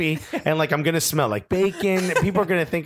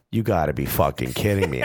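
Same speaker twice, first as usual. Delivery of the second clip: low, contained anger in a retold outburst; mutedly seething, pitched well down; retold fury; 10 semitones lower than usual. Diner retelling his reaction to being sprayed with mayo, low fury. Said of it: "you were furious".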